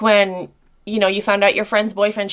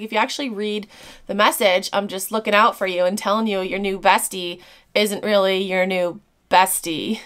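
ordinary talk, nothing marked

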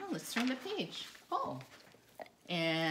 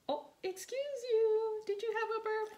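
much higher playful scolding; teasing baby talk; 13 semitones higher than usual. Acted. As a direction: tease the dog for burping. Teasing the dog after burp.

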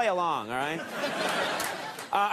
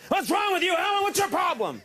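mock yell; 10 semitones higher than usual. Host showing the sailors how to yell at Alan, hammed-up shout. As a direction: berate him theatrically. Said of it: "Let's just yell at him right now".

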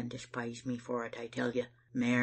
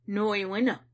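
higher, louder indignant refusal; angry, pitched-up retort; 5 semitones higher, 6 dB louder than usual. A narrator's reading. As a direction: refuse crossly, raising the voice. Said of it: "I said angry like".

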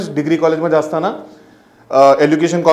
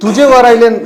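loud, high, scoffing judge; mocking laughing judge; 7 semitones higher, 3 dB louder, slower than usual. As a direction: jeer at the singer with a laugh. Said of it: "हकलून लावलं मला".

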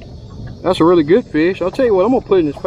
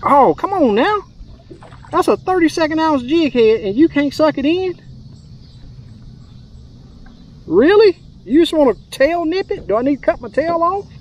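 much higher, teasing scold aimed at the fish; mock exasperation at fish; 9 semitones higher than usual. Real, not acted. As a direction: whine at the fish like it's cheating you. Angler scolding a fish that nibbles.

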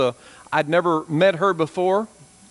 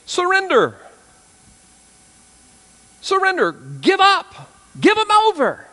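preaching at full force; 10 semitones higher than usual. Real, not acted. Preacher driving home surrender to his congregation, shouting high. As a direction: hammer each phrase from the pulpit. Preacher climaxing on a key word.

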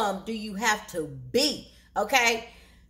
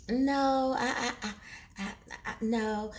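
soft stammered refusal; stuttering, unsure no; hesitant; 6 dB quieter than usual. Retold or imitated. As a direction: imitate her wavering, with halting pauses. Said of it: "so she paused. She was thinking about it".

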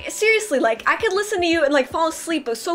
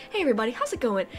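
calm soft greeting; 4 semitones lower, 7 dB quieter, much faster than usual. Retold or imitated. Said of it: "he's like".

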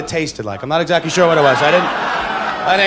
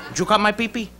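De Niro impression; 3 semitones higher than usual.

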